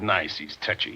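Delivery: breathy whisper, barely voiced; whispered aside. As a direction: slip it in under your breath. [name] aside to Hayes.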